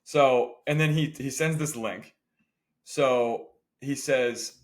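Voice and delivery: ordinary delivery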